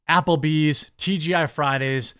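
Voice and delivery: ordinary delivery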